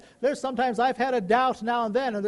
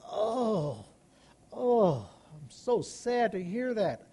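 softer, sighing oh, oh; mock groaning sadness; 5 dB quieter, much slower than usual. Shown as a demonstration. Pastor showing a groan of sorrow, soft and slow. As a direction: act out deep feeling, quiet and slow. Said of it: "acting with deep feeling".

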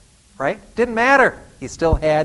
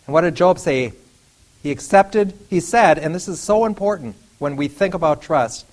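normal talking voice

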